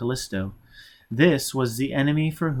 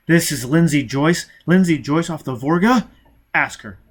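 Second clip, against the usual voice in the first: angry demand; 4 semitones higher, 6 dB louder than usual. Acted. Foyle furious demand.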